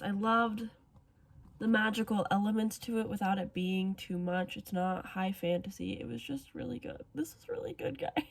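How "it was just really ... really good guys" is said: awed hushed whisper; whispered praise to viewers. Real, not acted. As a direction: breathe it out in wonder. Awed after finishing the book.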